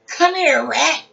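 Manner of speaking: loud, high come here rat; serious call. Acted. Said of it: "She's serious".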